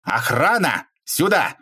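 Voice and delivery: short, high-pitched summons; barked call. A narrator's reading. Boss calling security into his office, barked shout. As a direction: bark it out through the door. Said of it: "Он распахнул дверь и рявкнул".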